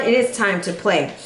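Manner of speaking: usual speaking voice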